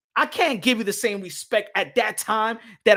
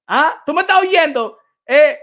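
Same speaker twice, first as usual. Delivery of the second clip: calling out; 6 semitones higher, 10 dB louder than usual. Real, not acted. Calling out to Ness.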